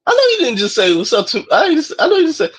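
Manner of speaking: imitating code-switch voice. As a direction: quote it lightly in a code-switched voice. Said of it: "it be sounding weird in that voice".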